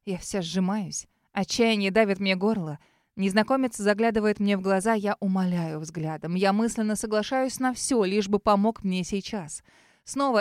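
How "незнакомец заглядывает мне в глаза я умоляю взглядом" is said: ordinary delivery, nothing marked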